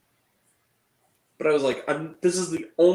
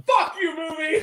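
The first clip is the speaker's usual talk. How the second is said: loud, high re-enacted yell; retold angry outburst; 9 semitones higher, 5 dB louder than usual. Retelling his reaction to the movie, angry shout.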